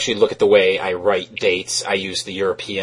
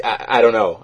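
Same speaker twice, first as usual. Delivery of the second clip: normal talking voice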